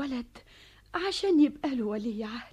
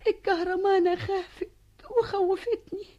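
high, rising, fearful telling; tearful dread; 5 semitones higher than usual. Acted. Mother tells daughter the king would kill them, tearful dread. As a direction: build towards terrified tears. Mother relives fear of execution.

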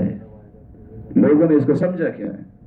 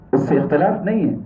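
ordinary talk

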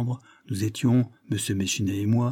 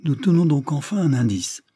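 higher-pitched exclamation of satisfaction; exclaimed with pleasure; 5 semitones higher, 4 dB louder than usual. A narrator's reading. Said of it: "s'écria-t-il". (exclamation de plaisir, voix plus haute)

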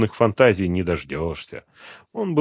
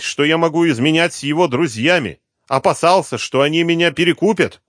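put-on higher, louder voice of a sulky young mistress; young woman; 7 semitones higher, 7 dB louder than usual. Kept woman answers the detective's questions, sulky. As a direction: answer him with a sulky edge.

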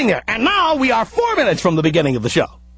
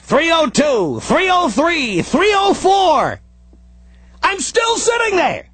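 slower and higher each time; slow building countdown; 5 semitones higher, slower than usual. Retold or imitated. Driver watching the clock, slow and rising. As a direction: drag out the minutes, rising frustration.

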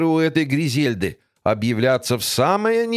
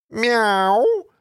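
meow; 8 semitones higher than usual. Acted. Cat meows from bush.

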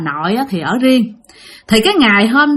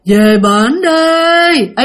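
moaning ghost voice; 5 semitones higher, 4 dB louder, much slower than usual. Retold or imitated. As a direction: moan it like a ghost rising from below. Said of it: "Mà giọng là giọng của một bà già". (giọng ma bà già rên rỉ, kéo dài rất chậm)

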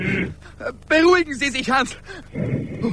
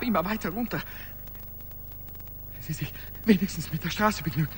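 ängstlich leise; 4 semitones lower, 9 dB quieter than usual. Acted. Verängstigtes Flüstern am Fenster.

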